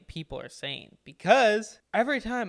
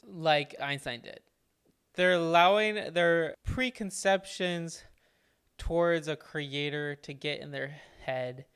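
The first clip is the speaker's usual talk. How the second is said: normal talking voice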